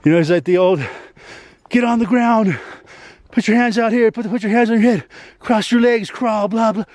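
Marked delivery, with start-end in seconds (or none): 1.7-6.8 higher, barked commands in a retold cop voice; mock cop yelling orders. Cop ordering the kid to crawl, mock barking. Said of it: "He's yelling all these fucking orders".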